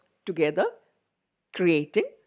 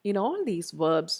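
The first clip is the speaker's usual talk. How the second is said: usual speaking voice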